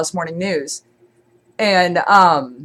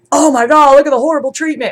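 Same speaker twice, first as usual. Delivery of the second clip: high, loud mock outrage; mock horror; 7 semitones higher, 4 dB louder, faster than usual. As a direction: act scandalized, pitched well up. Mimics an exposé's shocked tone.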